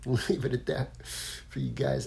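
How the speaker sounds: "Leave it at that" said through a chuckle; laughing. Real, not acted. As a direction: repeat "Leave it at that" while laughing. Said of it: "I'll leave it at that".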